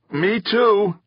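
very high, gleeful chime-in. A narrator's reading. Nico joining in the taunt, gleeful. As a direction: pipe up with nasty glee. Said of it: "Nico added gleefully".

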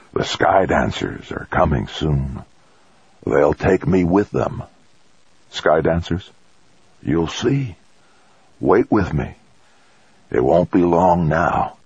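put-on slow, faint old man's voice; a dying man speaking of his visions; frail grandfather. A narrator's reading. Grandfather telling his grandson of the Sky Dancers, slow and frail.

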